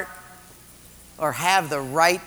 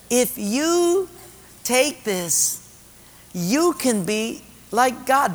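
higher, slowly drawn-out coaxing; tempting devil; 7 semitones higher, much slower than usual. Acted. Devil offering Eve to be like God, coaxing. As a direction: dangle the offer in a raised, stretched voice. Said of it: "what the devil was saying".

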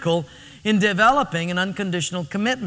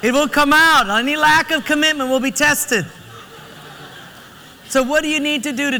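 emphatic preaching; 6 semitones higher, 4 dB louder than usual. Real, not acted. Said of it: "preaching point after joke".

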